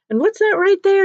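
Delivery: high, loud curious question; curious principal. Retold or imitated. Principal asks a little girl about her map, curious. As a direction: pitch it right up, asking a child.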